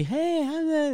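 high sweet voice for a dog; sweet dog greeting. Retold or imitated. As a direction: coo it like greeting a pup. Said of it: "you're supposed to be".

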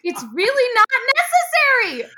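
high, loud mock fussy voice; mock prissy kid. Echoing nephew's reply to the witch, mock high and loud. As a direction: sing it like a fussy little know-it-all. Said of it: "That's exactly what he sounded like".

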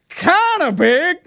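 high, slow put-on voice; mock Greg voice. Greg voiced in retold story.

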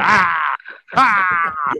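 loud, high mock cry; hammed-up wail. Man joins friends' teasing, loud mock wail.

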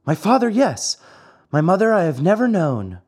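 high little puppet voice; high-voiced storybook child puppet; Pinocchio. A narrator's reading. Pinocchio voiced answering Fire Eater.

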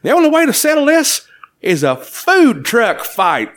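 high, slow announcing character voice; challenger's declaration. Acted. Character proposes a food truck fight, grand high voice. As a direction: proclaim a showdown with mock drama. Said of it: "and says".

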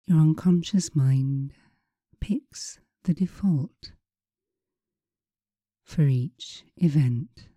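slow, soothing hypnotist voice. Acted. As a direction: guide them slowly into calm. Leads hypnosis session.

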